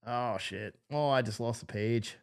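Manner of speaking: usual speaking voice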